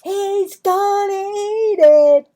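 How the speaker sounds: very high, loud, slow jeering call; bully announcing. Acted. Clarence tells everyone Marvin will eat it, very high loud jeer. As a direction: announce it to the whole table. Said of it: "Clarence announced".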